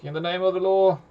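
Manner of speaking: high-pitched captain's proclamation; acted shout of authority; mock scream. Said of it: "he screams to the zombies".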